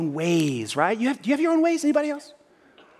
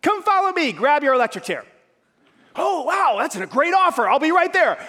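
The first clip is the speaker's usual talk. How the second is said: high, loud sarcastic enthusiasm; mock eager offer; 8 semitones higher, 6 dB louder than usual. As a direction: ham up a cheery invite to the electric chair. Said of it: "Would that be exciting?".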